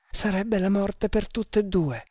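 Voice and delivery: frightened. A narrator's reading. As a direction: warn him fearfully in a high voice. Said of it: "esclamò la giovanetta con spavento". (con spavento, voce acuta)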